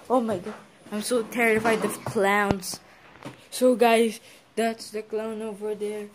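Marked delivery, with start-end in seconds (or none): none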